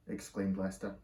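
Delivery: plain reading voice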